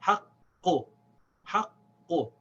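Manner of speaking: two slow demos of "haqqu", q pressed and held; doubled qaf. Shown as a demonstration. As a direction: close on the q, pause, release "haqqu". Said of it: "without breaking the sound of the qaf".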